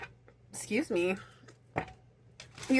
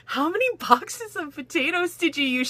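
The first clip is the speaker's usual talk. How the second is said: high, startled husband voice; astonished husband asking about potatoes; shocked husband; 8 semitones higher than usual. Retold or imitated. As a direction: ask it like you can't believe the mess. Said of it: "he's like".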